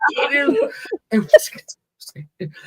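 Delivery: real tearful laugh; laughing through tears. Real, not acted. Guest joking about the topic while still crying. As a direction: break from sobbing into a laugh.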